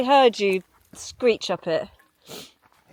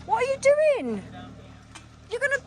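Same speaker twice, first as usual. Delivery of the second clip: very high terrified cry; terrified shriek. Real, not acted. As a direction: shriek it as the car tips. Jeep tipping, passenger terrified.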